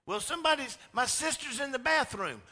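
put-on high, loud little boy whine; child excuse. Little boy excusing himself to his mom. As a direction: kid squeaking out an excuse.

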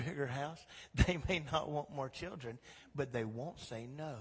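normal talking voice

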